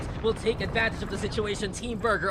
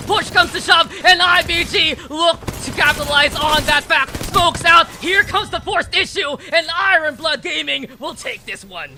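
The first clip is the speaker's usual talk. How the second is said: rising hype, much louder and higher; esports caster; 6 semitones higher, 7 dB louder than usual. Real, not acted. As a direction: call the fight building to a roar. Caster calls the final fight.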